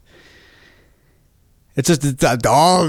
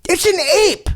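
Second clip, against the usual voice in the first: exasperated skeptic; 11 semitones higher than usual. Acted. Skeptic voice in mock debate.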